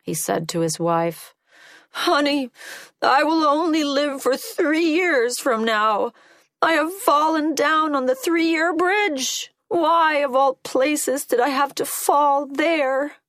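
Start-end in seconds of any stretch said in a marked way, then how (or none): 1.9-13.2 high, raised voice of woe; distressed lament. A narrator's reading. Old man telling his wife of his fall, high distressed lament. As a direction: wail it as a dramatic complaint. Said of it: "returned home very depressed".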